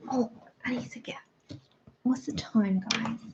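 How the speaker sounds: fast unvoiced whisper; quick hushed aside. Real, not acted. Sudden whispered aside to viewers.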